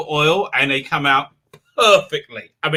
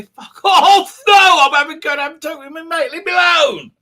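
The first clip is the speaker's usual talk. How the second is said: higher, laughing mock defiance; defiant Brits; 11 semitones higher, 5 dB louder than usual. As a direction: brush them off like a stubborn Brit, laughing. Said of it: "everyone's gonna say".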